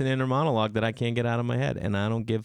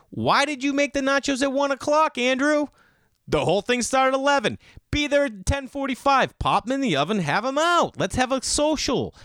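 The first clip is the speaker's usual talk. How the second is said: exasperated scolding; 13 semitones higher than usual. Retold or imitated. Scolding buddy over late nachos.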